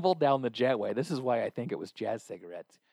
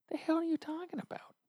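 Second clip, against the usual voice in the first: exasperated disbelief; 5 dB quieter than usual. Retold or imitated. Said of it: "in my head I'm like".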